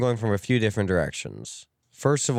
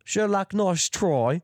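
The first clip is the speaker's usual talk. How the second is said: slow, high, British-Australian accent; drawn-out British accent slipping into Australian; British accent turning Australian; 9 semitones higher, much slower than usual. Shown as a demonstration. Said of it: "I'm the Australian sidekick".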